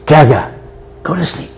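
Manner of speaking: put-on hushed toddler's bedtime order; lightly voiced granddaughter. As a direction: softly imitate a little girl saying goodnight. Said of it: "two-year-old granddaughter who loves to do this".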